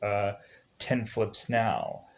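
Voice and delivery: ordinary talk, nothing marked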